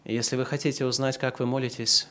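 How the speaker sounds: ordinary delivery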